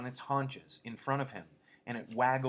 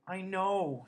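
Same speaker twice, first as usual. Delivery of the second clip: miserable; 10 semitones higher, 5 dB louder than usual. A narrator's reading. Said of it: "said Lenny miserably".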